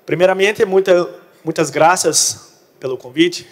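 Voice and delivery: Portuguese mixed with Spanish words; Portunhol. Real, not acted. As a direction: Brazilian speaking broken Spanish. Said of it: "hablo portunhol".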